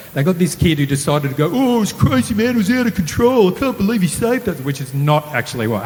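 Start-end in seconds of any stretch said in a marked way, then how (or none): 1.5-4.6 high, excited mimic of a kid on the news, pitched well up and louder; hamming up the attention-seeking kid; mock dramatic kid. Mocking the kid interviewed on news, high hyped-up voice.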